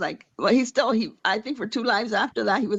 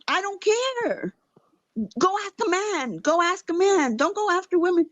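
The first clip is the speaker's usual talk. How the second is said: pitched-up imitation of a scolding; retold scolding; 9 semitones higher than usual. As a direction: tell him off in a raised pitch.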